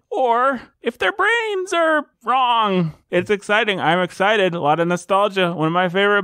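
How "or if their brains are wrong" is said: high, slow singsong teasing; slow, high-pitched tease. Pretend. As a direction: draw it out high with mock suspense. Mock threat in host intro.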